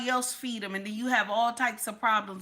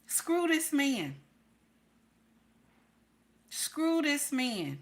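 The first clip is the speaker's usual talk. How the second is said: slow, drawn out and higher; slow emphatic; 4 semitones higher, much slower than usual. Real, not acted. Coaching wives, slow and emphatic. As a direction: stretch screw out for weight. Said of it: "slow emphatic repeat".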